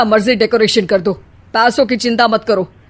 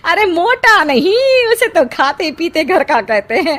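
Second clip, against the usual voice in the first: gossiping aunt. Acted. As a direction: show off the rich groom like a proud aunt. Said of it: "बाहर शीना की बुआ किसी से बात करती है". (शेखी बघारती बुआ की आवाज़)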